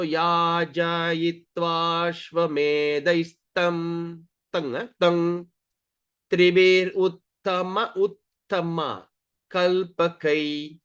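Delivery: level, chanted teaching pace; slow pronunciation demo. Shown as a demonstration. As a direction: intone it piece by piece.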